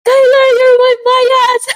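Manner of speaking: high, loud, slow fangirl squeal; high gushing cry of you're my bias; excited fan shout. Retold or imitated. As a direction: blurt it out like a starstruck fan. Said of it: "sabi ko talaga kay Kyler".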